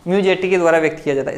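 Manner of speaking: rushed fast dictation; talking too fast. Real, not acted. Teacher rushing through notes, fast. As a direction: rattle the line off quickly. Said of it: "मैं कुछ ज़्यादा ही तेज बोल रहा हूँ".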